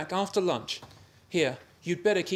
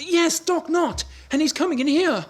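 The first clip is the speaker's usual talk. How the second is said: alarmed confirmation; 7 semitones higher, 5 dB louder than usual. Acted.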